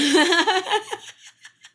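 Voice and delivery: sudden high burst of laughter; loud high laugh. Real, not acted. Laughing out loud at her teenage self. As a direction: cracks up loudly at herself.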